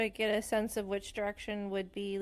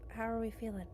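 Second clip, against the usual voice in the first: hushed; 2 semitones higher, 9 dB quieter than usual. Acted. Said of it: "trying to be whispers".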